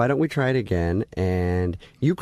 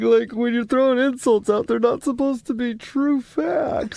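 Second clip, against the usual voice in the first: crying on the phone; 12 semitones higher than usual. Acted. Fake coach keeps acting hurt.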